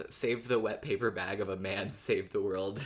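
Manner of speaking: ordinary talk, nothing marked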